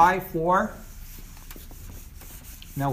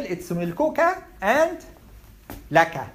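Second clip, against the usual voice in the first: milkuka said higher, stressing its final -a; teacher contrasting male and female forms; contrastive stress; 4 semitones higher, 3 dB louder than usual. Shown as a demonstration. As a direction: raise your voice on milkuka to set male against female. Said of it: "do you see the difference?".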